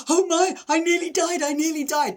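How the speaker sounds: high, loud, hammed-up hysteria; high-pitched pretend freak-out; mock panic. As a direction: act out a drama queen's wail. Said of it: "I could have gone".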